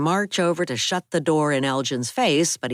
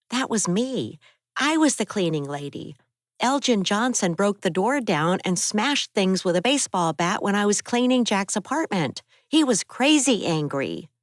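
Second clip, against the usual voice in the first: put-on higher young woman's voice, upset; raised, higher-pitched Abby telling of the break-in; Abby's voice; 7 semitones higher than usual. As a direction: voice Abby, shaken by the attack.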